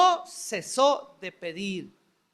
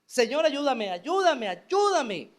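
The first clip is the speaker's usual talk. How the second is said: crying out; 4 semitones higher, 4 dB louder, slower than usual. Acted. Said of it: "se mantuvo gritando".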